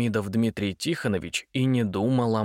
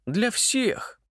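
excited; 11 semitones higher than usual. A narrator's reading. Митя proud.

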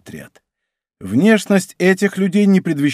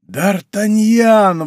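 drawn-out, raised cry of a name; exclamation; 6 semitones higher, 3 dB louder, much slower than usual. A narrator's reading. Beggar recognizing the musketeer as he steps back, exclaiming. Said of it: "воскликнул нищий".

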